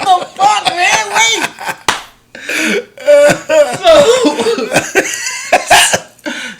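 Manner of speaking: high, loud disbelieving yelp; shocked outrage. Real, not acted. Caught off guard by his brother's prank, yelping high and loud.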